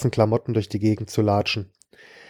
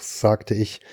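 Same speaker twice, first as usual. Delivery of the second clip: plain reading voice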